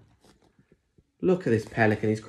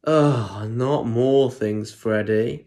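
louder, weary mum groaning at Freddy; exasperated mum; 4 dB louder, slower than usual. Acted. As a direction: sigh it out as a fed-up mum. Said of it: "said Mum".

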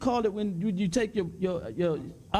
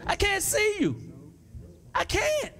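rising preacher shout; 10 semitones higher than usual. Real, not acted. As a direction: push it up into a fervent shout.

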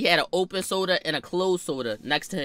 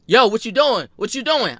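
calling out; 6 semitones higher, 6 dB louder than usual. Retold or imitated. Adult calling after walking kid.

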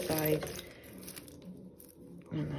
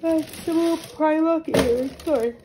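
yawning; 8 semitones higher, 8 dB louder than usual. Real, not acted. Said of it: "I'm yawning now".

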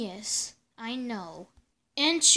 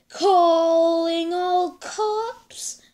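radio call; 3 semitones higher, 5 dB louder, much slower than usual. Acted. Chief calling the police.

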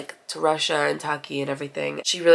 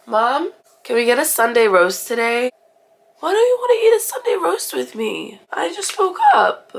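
put-on much higher, louder, pleading child whine; whiny begging; 7 semitones higher, 6 dB louder than usual. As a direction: wheedle mum like a little kid. Begging mum for Sunday roast.

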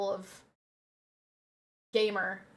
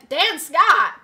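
excited shout; 5 semitones higher, 12 dB louder than usual. Real, not acted. Recognizing a TV character.

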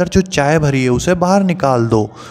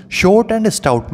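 slow, higher sing-song; recited rhyme line; 6 semitones higher, much slower than usual. Shown as a demonstration. Teacher reciting to children, slow and raised. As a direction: stretch the words out brightly for kids.

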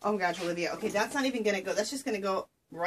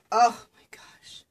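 whispered awe; 2 semitones higher, 8 dB louder, slower than usual. Real, not acted. Awed at lace gift.

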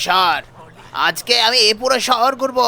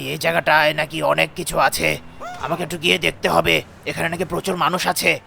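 menacing monster growling about eating people; low monster voice; 8 semitones lower than usual. Acted. Monster rampaging through the city, low and menacing. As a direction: sink into a low, hungry beast voice.

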